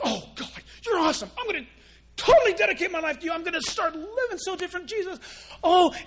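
high, fast, hammed-up zeal; over-the-top mountaintop vow; mock retreat fervour. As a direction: gush like you're fresh off a retreat.

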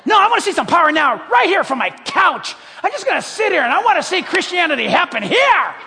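fast, loud, whiny lazy-believer voice; demanding couch believer. Retold or imitated. Pastor mocking the couch-bound believer with a fast, loud whine. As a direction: whine it like someone stuck on the couch.

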